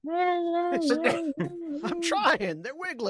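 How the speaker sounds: high, strained squeak; straining high voice. Retold or imitated. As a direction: squeak it out like you're straining hard. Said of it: "as the guy's like".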